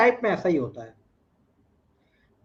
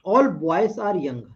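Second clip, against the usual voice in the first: slow statement read; much slower than usual. Shown as a demonstration. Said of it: "reading the statement slowly".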